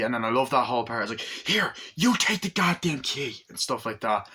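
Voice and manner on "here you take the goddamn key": high, exasperated imitated outburst; mock exasperation. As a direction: thrust the words out high, acting fed up. Acts exasperated handing over key.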